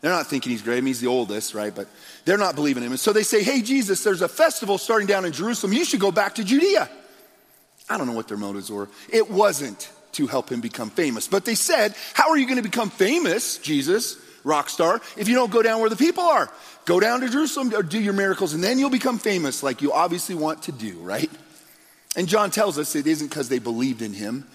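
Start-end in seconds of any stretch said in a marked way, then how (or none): 3.4-6.9 raised, pushy voice of the brothers, pitched higher; mock-urgent sibling prodding; goading brothers. 12.2-21.0 higher, sneering taunt; teasing sibling sarcasm; mocking brothers. Brothers mocking Jesus's fame.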